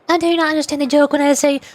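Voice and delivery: ordinary delivery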